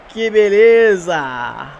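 drawn-out joyful cry; excited. Real, not acted. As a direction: savor it, stretched and loud. Celebrating the equaliser. (exclamação empolgada)

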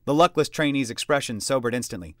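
ordinary delivery

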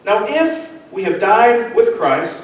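slow down and weigh the if; the tiny word if held up high; stress on if. Shown as a demonstration. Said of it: "I don't want to miss that if".

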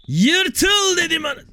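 high, drawn-out playful yell; mock battle yell. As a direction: holler it slowly while smashing something. Yells while smashing the wall. (oyunbazca uzatarak bağırma)

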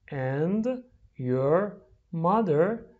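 "And ... your ... mother?" very slowly, word apart from word; slow, separated model reading for repeating. Shown as a demonstration. Teacher drills the question for repeating, word by word.

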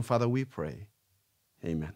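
ordinary talk, nothing marked

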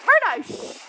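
sharp, high, loud hush; telling off the dog. Real, not acted. Owner hushing barking Bruno while filming, sharp loud shush. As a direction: cut the dog off firmly.

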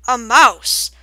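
loud, sonorous disbelief; unbelieving. A narrator's reading. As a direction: boom it as an incredulous owl. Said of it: "The sonorous voice sounded unbelieving".

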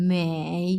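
মেই dipping down then up in a V; third tone. Shown as a demonstration. Teacher showing V-shaped third tone on মেই. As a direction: dip মেই low and bring it back up. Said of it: "V shape উচ্চারণ হবে".

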